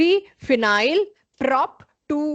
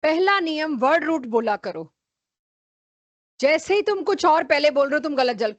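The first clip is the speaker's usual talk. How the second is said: usual speaking voice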